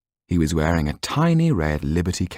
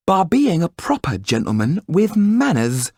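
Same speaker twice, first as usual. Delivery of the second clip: much higher, louder, pointed words; with emphasis; 13 semitones higher, 4 dB louder than usual. Acted. Wife praising Yan's manners, emphatic. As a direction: lean on every word. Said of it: "said the woman with emphasis".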